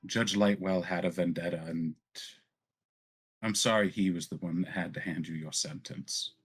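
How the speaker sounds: a lightly acted consoling noblewoman; measured reassurance, with long pauses; lightly voiced Zadali, consoling. Zadali comforts the disgraced Valen, lightly voiced and consoling.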